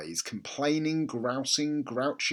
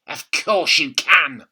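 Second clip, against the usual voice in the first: bossy grandma; 2 semitones higher, 10 dB louder, slower than usual. A narrator's reading. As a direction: lay down the rule slowly. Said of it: "she snapped".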